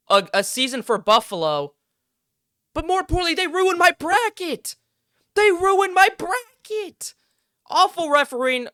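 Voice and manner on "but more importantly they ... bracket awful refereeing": milk the loss in a put-on whiny voice; mock whine. Fan mourning his ruined bracket to viewers, hammed-up high whine.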